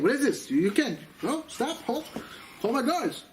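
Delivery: high-pitched mock official calling a halt; retold blustering command; indignant order. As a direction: stop them in alarmed outrage. Acted official ordering procession to stop.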